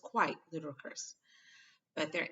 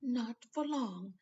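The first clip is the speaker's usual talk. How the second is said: satisfied; 8 semitones higher, slower than usual. A narrator's reading. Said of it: "in a satisfied tone".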